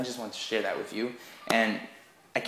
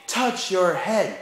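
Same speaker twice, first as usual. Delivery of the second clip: high, slow, louder game call; command without Simon; 8 semitones higher, 6 dB louder, much slower than usual. Shown as a demonstration. Teacher tricking the kids, slow high call. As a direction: call it out slowly to trick them. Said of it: "just say".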